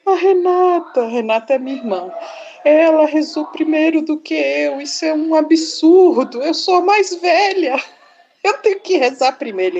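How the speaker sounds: put-on high-pitched, indignant little-girl complaint; hammed-up outraged kid, pitched high; child drama queen. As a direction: act out a child's over-dramatic grievance. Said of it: "dramática, que drama. Drama queen".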